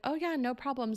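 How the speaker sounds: mock-casual "no problem" lilt; breezy pride. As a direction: imitate someone pleased with himself, waving it off. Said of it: "he was just so proud of himself".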